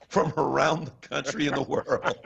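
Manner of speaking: words giving way to laughter; breaking into laughter. Real, not acted. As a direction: laugh helplessly through the phrase. Bursts out laughing mid-sentence.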